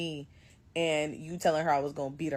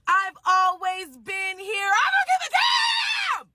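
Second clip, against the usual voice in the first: slow, very high, loud shriek; the other woman shrieking defiantly down the phone; screaming back; 13 semitones higher, 6 dB louder, much slower than usual. Retold or imitated.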